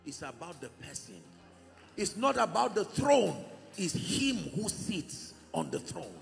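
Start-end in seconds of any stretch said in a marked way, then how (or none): 2.0-6.1 forceful preaching, much louder; loud harsh voice. Real, not acted. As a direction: thunder it from the pulpit. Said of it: "I apologize if I sound harsh".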